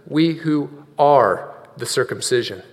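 low, soft reading with "are" set apart; stress on are. Shown as a demonstration. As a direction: pause around "are" to mark it. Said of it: "Present tense".

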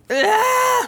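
sharp high yelp at the taste; a sudden startled Ah; dry wine gasp. Retold or imitated. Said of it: "my tongue was immediately like".

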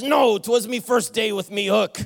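captain delivers the punchline in pirate voice. Acted. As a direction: land the joke as the old pirate. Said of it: "that stupid voice".

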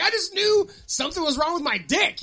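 high, loud protest; worked-up self-justification. Retold or imitated. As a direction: blurt it out in mock alarm. Acted alarmed self-justification to doctor.

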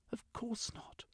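whispered curt reply; a dismissive whisper. Acted. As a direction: breathe the no out, barely voiced. Sylvia answers curtly.

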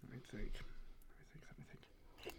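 whispered thinking aloud; whispering. Real, not acted. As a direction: murmur it to yourself, unvoiced.

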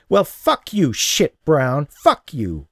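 high, loud angry cursing; hurt and furious, cursing him out. Acted. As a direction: spit the curses at him.

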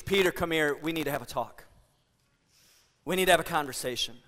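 soft, low and tender, an invitation; Jesus quietly calling a friend over; gentle call. Acted. Jesus calls Peter aside after breakfast, soft and tender.

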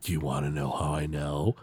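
low and soft, a spooky tease; creepy storyteller voice. Retold or imitated. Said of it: "And he's like".

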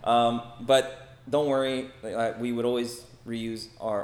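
ordinary talk, nothing marked

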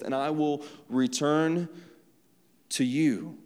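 preacher declaiming God's message; scripture reading. Acted. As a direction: proclaim the verse, then read it steadily. Rereads the passage, louder opening.

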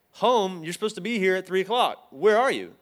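exasperated where-are-you; incredulous scolding. Retold or imitated. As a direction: push back on the no-show friend. Scolding friend for not showing.

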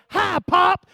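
high, loud mock child greeting; startlingly loud imitation of a small child; little granddaughter. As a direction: pipe up suddenly like a little girl. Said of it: "and she went".

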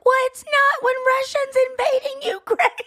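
high-pitched imitated protest; high, exasperated mock comeback; mortified daughter. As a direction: mimic a daughter snapping back, mortified. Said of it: "Tatum came home just mortified".

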